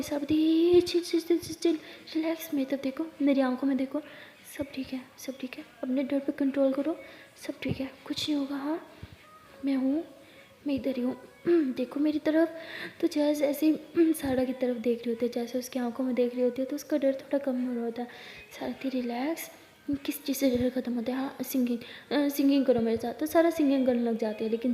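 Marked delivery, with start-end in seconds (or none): 0.8-12.5 much softer, nearly whispered; hushed calming. Acted. Sara hushes frightened Jess, whispering calm. 19.0-23.1 much softer, half whispered; soft soothing. Acted. Sara softly suggests singing to calm Jess.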